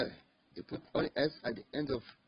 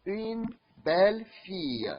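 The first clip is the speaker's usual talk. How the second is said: Une belle fille drawn out slowly and higher, stress on belle; slow model phrase; 4 semitones higher, slower than usual. Shown as a demonstration. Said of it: "we say".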